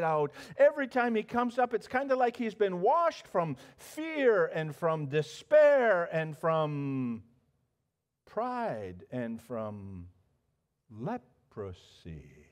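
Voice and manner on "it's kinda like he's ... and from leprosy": slowing, fading close. Real, not acted. Preacher slows on Naaman's cleansing.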